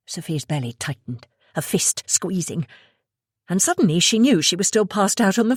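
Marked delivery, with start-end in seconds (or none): none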